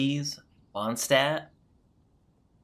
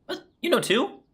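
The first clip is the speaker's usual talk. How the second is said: surprised; 5 dB louder than usual. Real, not acted. Streamer surprised by the news.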